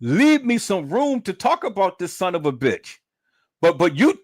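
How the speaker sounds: ordinary delivery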